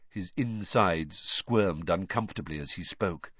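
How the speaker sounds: ordinary delivery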